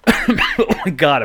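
wheezy after a cough; slow and wheezy after coughing; wheezing. Real, not acted. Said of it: "apparently I'm wheezing now".

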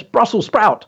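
"Brussels sprout" exclaimed high, then falling; performance-poem punch; emphatic dragon line. Shown as a demonstration. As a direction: call it out with relish. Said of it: "So the emphasis is on".

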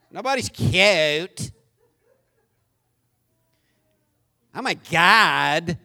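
mocking imitation. Mocking the girls' speech.

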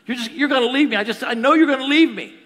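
high, loud, clingy outburst; needy accusation. Retold or imitated. Needy spouse fearing abandonment, clingy accusation. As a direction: imitate a panicky partner accusing loudly.

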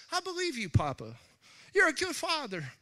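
put-on high, little-child voice; childlike trust. A child telling God he believes him, childlike. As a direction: say it like a small trusting kid.

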